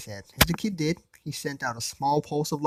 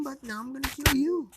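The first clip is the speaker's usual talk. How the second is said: menacing killer; 13 semitones higher than usual. Acted. Priest threatens Issei.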